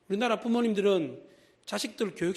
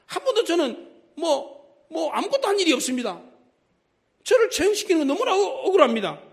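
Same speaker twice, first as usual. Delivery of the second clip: a condemned man protesting, rising high and loud; pleading prisoner; 9 semitones higher, 6 dB louder than usual. Retold or imitated. Man facing execution begs his captors, rising plea. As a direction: plead your innocence, voice climbing to a cry of injustice.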